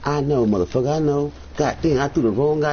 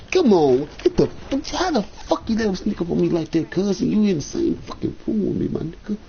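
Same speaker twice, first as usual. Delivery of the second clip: high, angry yelling; furious outburst; 7 semitones higher than usual. Real, not acted. Furious at teammate.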